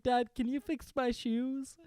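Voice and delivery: put-on high, drawn-out child's plea; slow, high-pitched kid voice; little kid asking Dad. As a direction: ask Dad for help like a small kid. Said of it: "I'm like".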